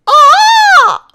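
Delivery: very high, loud piercing shriek; loud peacock cry. A narrator's reading. Peacock shrieks at whizzing cars, loud and high. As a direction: blast it at the passing cars. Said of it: "cried out in his loudest voice".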